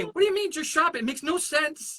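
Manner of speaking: high, baffled mock question; confused audience. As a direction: play the puzzled crowd member.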